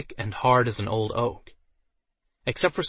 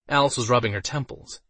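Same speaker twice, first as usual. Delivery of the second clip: plain reading voice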